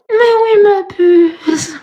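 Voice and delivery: high whiny mimic of a friend's talk; mocking Steph's voice. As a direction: squeal it like a weepy caricature. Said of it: "C'est à cause de Steph que je parle comme ça".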